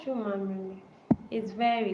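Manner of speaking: ordinary talk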